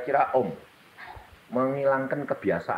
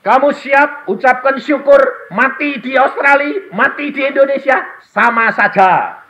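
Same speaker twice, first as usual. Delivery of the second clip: retold words to wife; 15 dB louder than usual. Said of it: "Saya cuma berkata gini".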